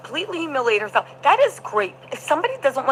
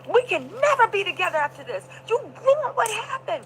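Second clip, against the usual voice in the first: hammed-up heartbreak, pitched up; mock distraught reproach; 8 semitones higher than usual. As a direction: imitate a melodramatic breakup speech. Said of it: "He said".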